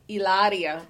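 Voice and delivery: Hilaria rolled out as ee-LAH-dee-ah in a put-on Spanish lilt; mock Spanish Hilaria. Shown as a demonstration. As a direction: give Hilaria an exaggerated Spanish accent. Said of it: "And also".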